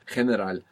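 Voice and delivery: General said with throat friction, heh-ne-RAL; Spanish j sound. Shown as a demonstration. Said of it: "causing a bit of friction in the throat".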